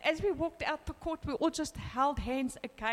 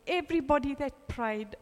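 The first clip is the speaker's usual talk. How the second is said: ordinary delivery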